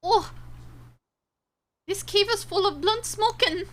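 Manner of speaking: hammed-up German accent, pitched high; attempted German accent. Streamer exclaiming about a smoky cave, mock German accent. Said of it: "I don't know how to do a German accent".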